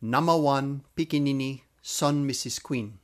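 Namawan pikinini son Missus Queen said in one flow; full phrase Namawan pikinini son Missus Queen, even pace; pidgin demo. Shown as a demonstration. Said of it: "here's a really tough one".

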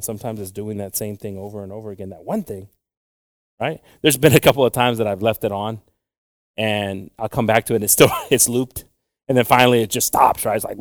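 usual speaking voice

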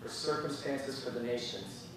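prayer. Acted. Leads a closing prayer.

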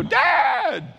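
high, loud wail for a parent; mock hurt-kid cry; little boy calling. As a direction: holler it like a child with a boo-boo.

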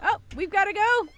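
loud, high theatrical wail; dramatic mock despair. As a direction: ham up a tragic farewell. Mock dramatic exit being dragged.

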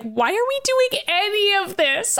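a high mock annoyed whine; exasperated Wanda. Wanda to Kurt, a high fed-up mock whine. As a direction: sigh it out, fed up. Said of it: "Wanda's like".

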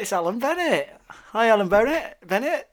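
excited greeting. Real, not acted. Host greets guest excitedly.

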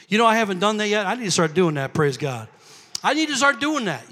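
ordinary talk

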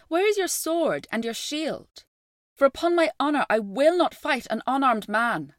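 put-on young boy voice calling out. A narrator's reading. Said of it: "called the boy from across the river".

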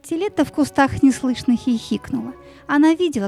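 plain reading voice

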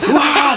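playful imitation of an excited scream; imitated scream. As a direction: let out a high "wah" mimicking screaming.

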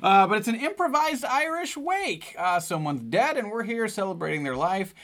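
hammed-up Irish ad read; Irish accent.

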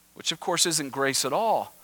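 ordinary talk

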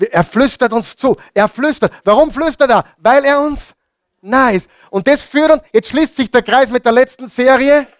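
high, urgent preacher's proclamation; impassioned preaching. Real, not acted.

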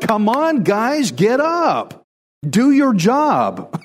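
high, slow, drawn-out urging; exasperated God. Acted. God telling Israel's men to step up, exasperated. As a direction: plead with slackers in exasperation. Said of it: "God's like".

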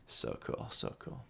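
breathy delighted whisper. Real, not acted. Host whispering to listeners, thrilled. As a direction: hush it like a secret thrill.

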